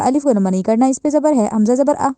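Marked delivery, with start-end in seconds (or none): none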